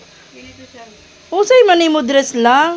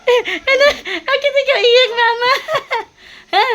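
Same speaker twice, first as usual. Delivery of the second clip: pitched way up and laughing through it; high giggly teasing; 10 semitones higher than usual. Real, not acted. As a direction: giggle out the question to mom. Teasing mother who is crying.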